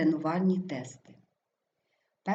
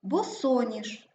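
Босоніж slowly, stressed бо-СО-ніж; 3 semitones higher, slower than usual. Shown as a demonstration. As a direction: stretch out СО in Босоніж. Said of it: "Наголос падає на О". (Босоніж повільно, з наголосом на СО)

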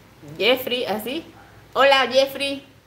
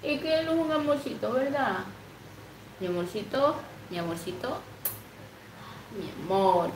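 tender softer pet talk; 3 semitones higher, 6 dB quieter than usual. Real, not acted. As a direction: fuss over the pet tenderly. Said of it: "Yo le hablo así".

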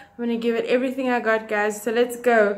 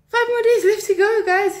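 excited; 7 semitones higher, 5 dB louder than usual. Real, not acted. Excited outro.

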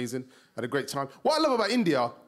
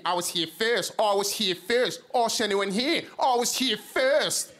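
put-on Australian accent growing higher and slower; insistent drunk Aussie; 4 semitones higher, slower than usual. Drunk Aussie insisting on his chair, escalating Australian accent. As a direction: repeat it insistently, stretching the Aussie vowels. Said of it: "he kept saying that to me".

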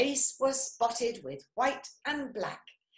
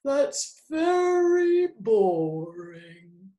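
slow, loud drawn-out boredom; long bored drawl; bored, sleepy sloth; 7 dB louder, much slower than usual. A narrator's reading. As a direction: drag the words out as a drowsy sloth. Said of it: "soon he started to snore".

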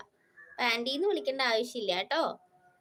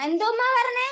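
surprised outburst; 11 semitones higher, 7 dB louder than usual. Real, not acted. Surprised at a comment.

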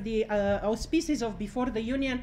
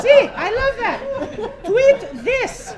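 laughing delight; 10 semitones higher, 8 dB louder, slower than usual. Real, not acted. Laughing cheer.

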